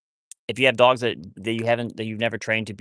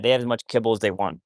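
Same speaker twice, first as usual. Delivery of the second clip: normal talking voice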